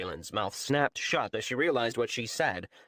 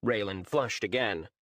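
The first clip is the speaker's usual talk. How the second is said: plain reading voice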